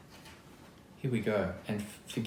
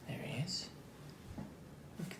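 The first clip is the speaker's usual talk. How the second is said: breathy whispered aside; 8 semitones higher, 12 dB quieter than usual. Real, not acted. Reader whispering to children about the picture, whispering. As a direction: whisper it as you point to the page.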